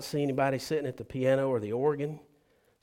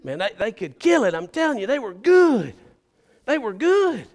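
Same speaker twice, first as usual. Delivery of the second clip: excited churchgoer; 7 semitones higher, 9 dB louder than usual. Retold or imitated. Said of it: "he says".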